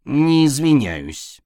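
snooty cat. A narrator's reading. Cat pointedly refuses apology.

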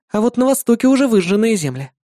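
put-on high bright girlish voice; young woman voice. A narrator's reading. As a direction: voice the demon girl cheerfully. Irda tells about the empire.

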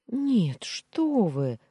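high, loud, alarmed protest; flustered high-pitched no; flustered denial. A narrator's reading. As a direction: brush it off in a panic. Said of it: "всполошилась Амина".

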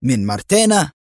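surprised question lifting at the end. A narrator's reading. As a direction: ask with understated astonishment. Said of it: "سأله أليكسي في دهشة". (بدهشة خفيفة)

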